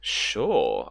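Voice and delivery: normal talking voice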